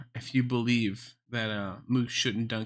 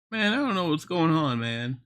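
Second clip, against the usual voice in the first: higher, louder mock-despondent moose; lightly acted moose complaint, raised in pitch; sad moose; 4 semitones higher, 5 dB louder than usual. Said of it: "he's, like, fucking sad 'cause he's a moose".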